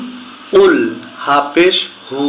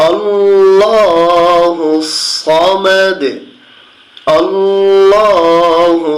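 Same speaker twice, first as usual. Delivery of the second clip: Quran recitation; 3 semitones higher, much slower than usual. Shown as a demonstration. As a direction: lift and stretch আল্লাহু in a long chant. Teacher recites verses melodically.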